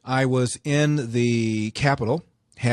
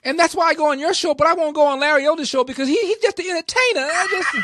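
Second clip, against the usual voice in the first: much higher and louder, stammering, whiny put-on voice; hammed-up flustered politician dodging the question; shrill squeaky stammering caricature; mocking Maxine Waters; 7 dB louder than usual. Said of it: "And she said".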